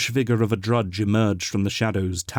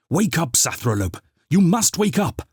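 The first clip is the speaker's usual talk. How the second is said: urgent call to wake; 8 semitones higher, 3 dB louder than usual. A narrator's reading. Glospin urgently rousing sleeping housekeeper.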